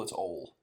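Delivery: "ole" said as one short syllable; Southern ole. Shown as a demonstration. Explaining Southern speech, "ole" as one syllable. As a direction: drop the middle sound of "ole". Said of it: "instead of oil".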